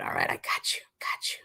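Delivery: soft whispered aside. Real, not acted. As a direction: whisper it low to chat.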